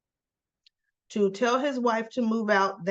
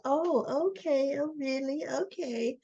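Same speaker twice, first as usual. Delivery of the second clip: higher-pitched mock-agreeable okay; put-on higher, softer acceptance; switched-on breezy reaction; 5 semitones higher, 4 dB quieter than usual. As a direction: act out a sudden switch to playing along. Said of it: "she ended up flipping the switch".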